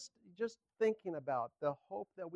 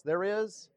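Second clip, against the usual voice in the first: "is" hit high and loud; stress on IS; 6 semitones higher, 8 dB louder than usual. Shown as a demonstration. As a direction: punch the word "is" firmly. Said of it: "with the emphasis on is".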